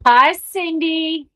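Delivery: high, loud, slow hello; cheerful greeting. Real, not acted. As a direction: greet her brightly. Greeting a viewer.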